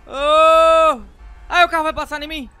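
high loud fast cry of fright; panicked shout; terrified yelp. Real, not acted. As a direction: yell as the car comes at you. Car about to hit him.

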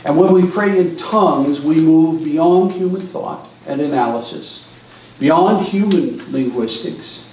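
ordinary delivery

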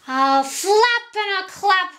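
high, squawked eagle threat; squawking eagle. A narrator's reading. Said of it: "he squawked".

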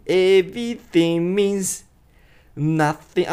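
slow "Everything means", no G; G dropped. Shown as a demonstration. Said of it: "このGが消えて".

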